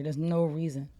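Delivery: usual speaking voice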